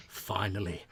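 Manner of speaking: eager would-be hero. Acted. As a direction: savour the moment, building to glory. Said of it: "she's like".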